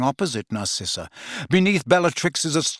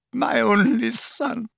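1 sobbing; 9 semitones higher than usual. A narrator's reading. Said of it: "sobbing and moaning".

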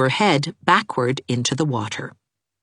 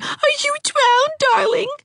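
put-on high child's cry; high, anxious girl's cry; cried out. A narrator's reading. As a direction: cry out as a frightened little girl. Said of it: "cried Geraldine".

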